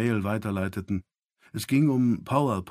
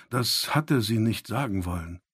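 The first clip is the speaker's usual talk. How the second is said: plain reading voice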